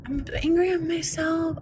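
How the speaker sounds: high, soft, slow mock whimper of a useless apology; mocking a meek apology. Mocking the silent streamer's meek excuse, high soft whimper. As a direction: ham up the limp, weepy excuse to sneer at it. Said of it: "But you going".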